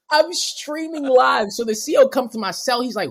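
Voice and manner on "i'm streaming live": high, loud, drawn-out holler; mock hollering. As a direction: stretch it out in a re-enacted shout. Mock re-enacting his jailhouse livestream boast.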